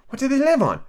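put-on high girlish question; curious Alice. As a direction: ask it high with keen interest. Alice voiced in story.

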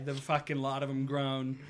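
ordinary talk, nothing marked

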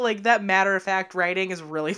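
ordinary delivery

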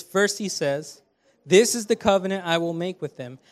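loud, declarative Bible reading; scripture reading. Acted. Preacher reading Hebrews ten fourteen aloud, declarative scripture reading. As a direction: proclaim the verse to the congregation.

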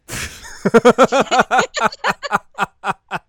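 loud, high cackle tapering into chuckles; real burst of laughter. Real, not acted. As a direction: crack up hard and keep laughing. Reaction to surprise band reference.

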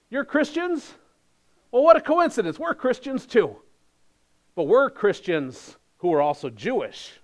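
high, mock-friendly sneering voice; mock sneering teacher. Preacher mimics false teacher, smug and high-pitched.

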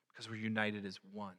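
ordinary talk

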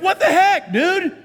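high, loud, laughing disbelief; laughing mock outrage at a baby; mock exasperation. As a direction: laugh through a mock rebuke. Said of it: "It's like".

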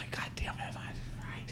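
grudging whisper, breath only. Retold or imitated. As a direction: whisper it through your teeth. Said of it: "I'm like".

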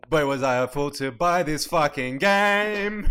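loud mock regret; loud hammy lament. Same meme line mocked.

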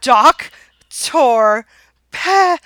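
DOC-TOR PEP-PER, each syllable loud and separate; syllable-by-syllable repeat. Retold or imitated. Exasperated husband repeating his order, syllable by syllable. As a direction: break Doctor Pepper into loud, halting chunks.

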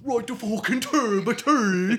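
high, loud mock old-timey Irish accent, laughing. Host imitates drunk miner's Irish brogue, hammed-up and laughing. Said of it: "worst Irish accent I've ever heard".

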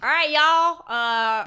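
put-on Southern accent. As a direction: ham up a Southern belle accent, pitched high. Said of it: "Let me get in my Southern persona".

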